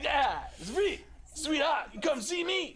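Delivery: very high shouted [name] voice; [name] impression. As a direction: holler it like a wired soul star. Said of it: "He's like".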